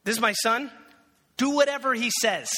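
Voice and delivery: loud, high call to the servants; Mary calls out, commanding and urgent. Retold or imitated. Mary summons the servants at the party, loud and commanding. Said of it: "And then she goes".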